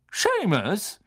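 high called-out name; Lupin. A narrator's reading. Teacher summoning Seamus forward, calling out. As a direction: call the pupil's name out. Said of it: "rief Professor Lupin".

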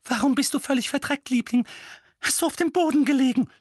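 high, loud motherly voice; frantic high-pitched mother; fussing, alarmed mother. Acted. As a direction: fuss loudly over your darling son.

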